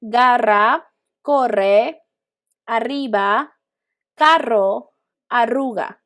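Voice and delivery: "garra, corre, arriba, carro, arruga" with a short trilled RR; slightly rolled R. Shown as a demonstration. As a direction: model each word with just a slight roll. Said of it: "slight roll will be".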